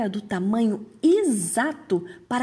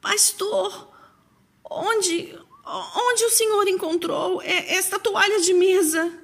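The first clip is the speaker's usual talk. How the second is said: put-on nervous old woman, much higher pitched; halting, high-pitched nervous question; 9 semitones higher than usual. A narrator's reading. Old woman asks the pastor about the tablecloth, very nervous. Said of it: "com voz muito nervosa".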